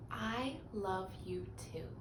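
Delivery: soft, slow, hushed; tender. Real, not acted. Teacher telling the children she loves them, hushed. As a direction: say it gently to the little listeners. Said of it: "tender close to children".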